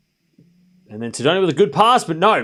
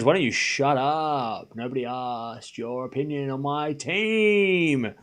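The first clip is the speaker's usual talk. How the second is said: slow, softer, stretched-out put-down; drawn-out telling-off; 2 semitones lower, 6 dB quieter, slower than usual. Real, not acted. Streamer tells chatter George to shut up about the team, slow exasperated drawl. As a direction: drag out the words in exasperation.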